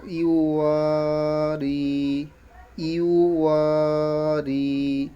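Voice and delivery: ইউ ওয়া রি with long ওয়া and long রি; stretched vowels. Shown as a demonstration. Teacher joining the syllables for pupils, slow elongated vowels. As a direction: slowly draw out ওয়া and রি. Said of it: "এটাও একটু কি টান দিয়ে".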